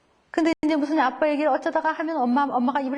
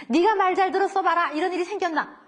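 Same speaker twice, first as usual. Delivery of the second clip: scolding parent; 7 semitones higher than usual. Retold or imitated. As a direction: snap at the child with blame.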